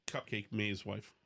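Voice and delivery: normal talking voice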